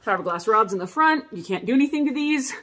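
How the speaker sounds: ordinary talk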